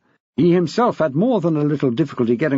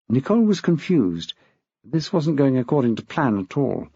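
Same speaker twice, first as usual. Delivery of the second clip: plain reading voice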